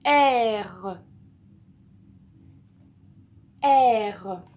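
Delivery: "R" loud and drawn out as "ère", falling; model for repeating. Shown as a demonstration. Teacher has the learner repeat the sound, "R" long and loud. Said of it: "Dis-le une fois".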